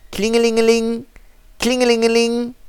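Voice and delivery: high sing-song ringing phone; phone ring imitation. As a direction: ring it out like a telephone.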